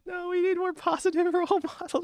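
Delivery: very high mock-earnest plea; squeaky high voice. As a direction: say it in a squeaky falsetto. Mock role model plea.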